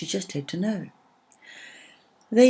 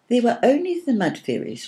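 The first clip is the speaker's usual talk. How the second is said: firm, higher insistence; 9 semitones higher, 5 dB louder than usual. A narrator's reading. Willow firmly repeats the start for Arwen, firm and higher. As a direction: set it straight, firmly. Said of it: "repeated Willow firmly".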